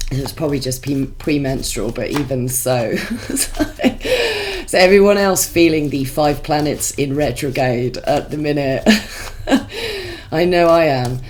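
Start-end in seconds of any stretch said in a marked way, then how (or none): 3.0-4.6 a bright, high burst of laughter. Real, not acted. Laughs at her own remark. 8.9-10.3 a short, high laugh at her own words. Real, not acted. Laughs about the retrograde.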